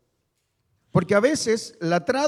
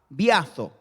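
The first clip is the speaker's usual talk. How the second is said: "Biazo" con el golpe de voz en la A; acento en la A; 5 semitones lower than usual. Shown as a demonstration. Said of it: "tilde o acento en la A". ("Biazo", sílaba tónica en la A)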